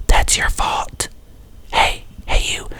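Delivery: slow, whispered aside to the audience; hushed call-out. Real, not acted. Creator telling off complaining viewers, pointed whisper. Mock-confiding rebuke to the viewers.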